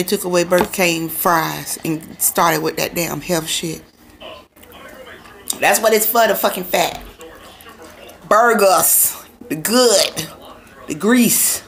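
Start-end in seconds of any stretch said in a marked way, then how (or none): 0.0-7.0 muffled behind fingers; speaking through covered mouth; hand over mouth. Real, not acted. Seen 0: Hand covers mouth from 0.9s onward; mouth open and visible before that.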